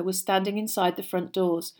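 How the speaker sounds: ordinary delivery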